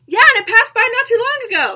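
high, loud, eager voice; hammed-up, bubbly movie-scene reply; chirpy cartoon fish. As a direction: pipe up like an excitable cartoon helper. Acting out animated film scene.

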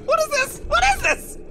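high, loud whooping disbelief; wild, high-pitched exclamation; exhilarated. Acted. Overwhelmed by his new power.

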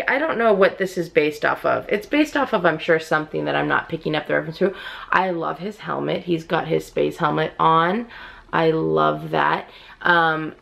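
ordinary talk, nothing marked

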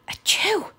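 sharp whispered achoo; sneeze. Acted. Chase sneezes.

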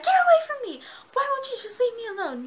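high, loud pleading shout; mock angry yell. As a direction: yell at him to leave you alone. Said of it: "she's like".

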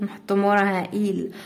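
ordinary talk, nothing marked

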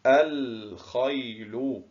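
الخيل drawn out slowly, the lam clearly sounded; slow demo of al-khayl. Shown as a demonstration. Teacher to pupils, showing the sounded lam, slowly. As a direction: stretch out الخيل so the moon lam is heard. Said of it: "هنا في كلمة الخيل".

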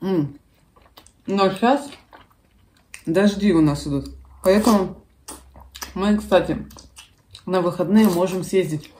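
chewing while talking; mouth full. Real, not acted. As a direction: between spoonfuls of soup. Seen 4: Woman holds spoon, cheeks bulge with food while speaking.

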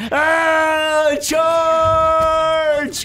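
loud, high charging yell; battle cry. Acted. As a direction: roar it like leading a charge.

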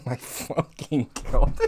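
slow, soft disbelieving laugh; laughing softly. Real, not acted. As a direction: laugh it out under your breath. After prank call.

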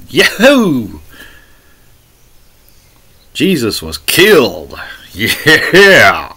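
sarcastic cheer with drawn-out exclamations; mock excitement. Preacher parodying glee that Jesus was killed, mock excitement. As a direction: pretend to celebrate, stretching the yahoo and yeah. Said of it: "Getting excited about killing Jesus".

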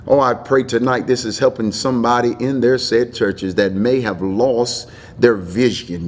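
ordinary talk, nothing marked

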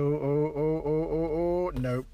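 ordinary talk

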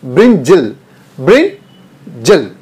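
ब्रिंजल with a short unstressed end, brin-jel; correct pronunciation, slow. Shown as a demonstration. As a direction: say ब्रिंजल slowly, not ब्रिंजॉल. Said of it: "ब्रिंजॉल नहीं, ब्रिंजल". (ब्रिंजल धीरे और साफ़, आख़िर में छोटा अ)

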